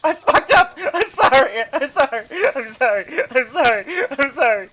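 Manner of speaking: loud frantic apologizing; yelling apology. Real, not acted. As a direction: shout sorry over and over. Apologizing while fleeing.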